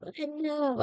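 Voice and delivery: squeaky smug "I know"; high mock-smug voice. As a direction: pipe it up, pleased with yourself. Mock self-praise.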